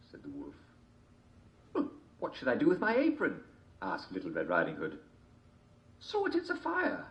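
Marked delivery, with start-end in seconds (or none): 1.7-3.4 put-on girl voice. A narrator's reading. 6.0-7.0 high wolf voice. A narrator's reading.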